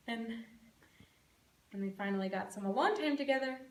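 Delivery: acted frail tenderness, held back; subdued bedside recollection. Mother recalling her sick son's question, quiet and subdued.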